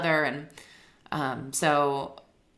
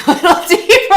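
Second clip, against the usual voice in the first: laughing; 14 semitones higher, 14 dB louder than usual. Retold or imitated. Laughing while quoting cowriter.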